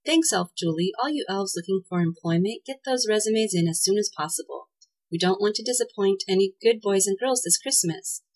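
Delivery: usual speaking voice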